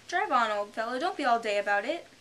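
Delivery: loud, impatient griffin; impatient urging. Acted. The Griffin prodding the Mock Turtle, impatient. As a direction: hurry the old fellow along.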